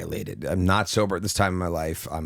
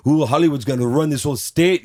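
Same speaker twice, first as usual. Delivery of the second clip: higher, louder gleeful Arnold; greedy excited Arnold; 7 semitones higher, 6 dB louder than usual. Retold or imitated. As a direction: relish it, seeing dollar signs. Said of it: "like, seeing dollar signs".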